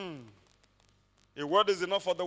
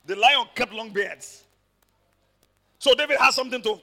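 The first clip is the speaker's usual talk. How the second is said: ordinary delivery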